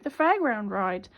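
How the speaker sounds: ordinary delivery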